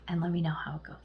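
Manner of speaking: whispered sign-off; soft whisper. Real, not acted. Tarot reader whispering goodbye to the viewer. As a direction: breathe the farewell to the viewer.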